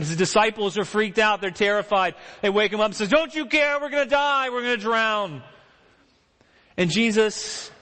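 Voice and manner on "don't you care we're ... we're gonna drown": high, frantic cry of fear; panicked plea. Retold or imitated. Disciples to sleeping Jesus in the storm, high panicked plea. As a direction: wake him up screaming you'll drown.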